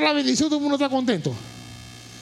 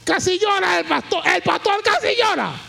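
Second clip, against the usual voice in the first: high, raised preaching voice; exclaiming preacher; 8 semitones higher, 4 dB louder than usual. Real, not acted. Preacher tells how the pastor nearly cried, exclaiming preacher. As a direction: call it out to the congregation, high and strong.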